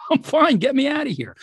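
high, loud retold yell up the mountain; yelling back. Climber yelling up to his team from the mountainside, loud and high. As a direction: call it up like you're stuck on ice. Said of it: "yelled back".

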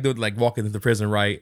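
ordinary delivery

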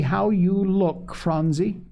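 high, drawn-out exclamation; mild fond dismay; dismayed cry. A narrator's reading. Sister seeing the child's rubbed face, dismayed cry. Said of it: "cried Polly".

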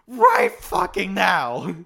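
loud, slow mock bellow; yelled order. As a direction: shout it word by word as the character. Repeats the retold yelled order.

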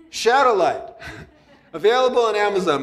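laughing through the book plug, quick and lifted; a sheepish chuckle as he sneaks in an ad. Real, not acted. Laughing self-plug of his book.

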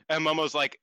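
ordinary talk

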